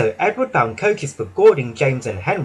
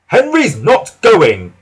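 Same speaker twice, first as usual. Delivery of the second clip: higher, louder, slow defiant engine; Gordon's voice; 6 semitones higher, 7 dB louder, slower than usual. Acted. Gordon refusing to shunt for the Fat Controller, slow and defiant. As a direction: refuse flatly and proudly. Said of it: "said Gordon".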